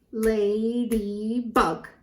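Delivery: "Ladybug" slowly in three drawn-out beats; syllable by syllable. Shown as a demonstration. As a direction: count out each syllable of "Ladybug". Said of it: "How many syllables?".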